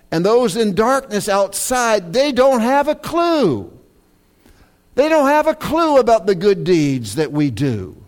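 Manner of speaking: preaching at full force. Acted. Pastor urging the congregation, in full preaching voice. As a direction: drive the point home to the church.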